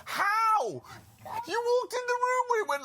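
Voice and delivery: high-pitched incredulous cry; exasperated outburst. Real, not acted. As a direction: shout your disbelief at the screen.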